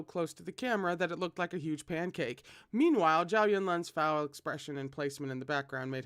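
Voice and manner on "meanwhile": usual speaking voice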